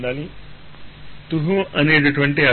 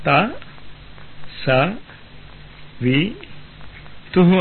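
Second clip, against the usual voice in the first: syllable by syllable; 5 semitones higher than usual. Shown as a demonstration. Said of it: "అనగలుగుతున్నాం మనం".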